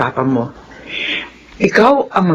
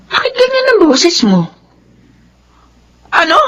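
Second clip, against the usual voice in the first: much higher, worried question; startled by her son's voice on the phone; alarmed; 11 semitones higher than usual. Acted. Mother alarmed on phone call. (nag-aalalang tanong)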